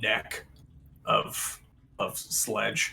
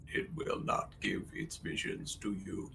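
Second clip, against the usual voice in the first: soft unvoiced whisper; whispered construct voice; 7 dB quieter than usual. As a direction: breathe it like a hollow construct. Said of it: "It says".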